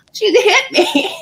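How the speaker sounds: high, slowed words through real laughter; laughing protest. Real, not acted. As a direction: protest helplessly while laughing. Laughing protest over the slap.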